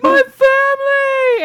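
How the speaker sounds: very high, loud, stretched-out cry of joy; overjoyed dog. Acted. Chance spotting his family, overjoyed. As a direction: yelp it like a dog finding home.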